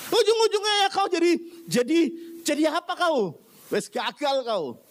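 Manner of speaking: loud, high scolding that drops at the end; judgmental parent's outburst. Acted. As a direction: berate a failed child as a hammed-up parent. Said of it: "jangan hakimi dia lalu berkata". (bentakan orang tua yang menghakimi)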